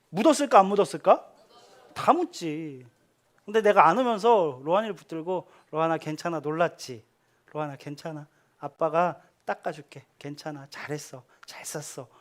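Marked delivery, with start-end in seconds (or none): 5.7-12.1 soft, low hushed reassurance; tender comfort. Retold or imitated. Father hugging his son Rohan, soft hushed comfort. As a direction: soothe him gently, almost whispering. Dad comforting frightened toddler.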